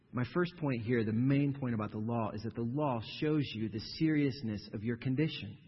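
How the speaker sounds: usual speaking voice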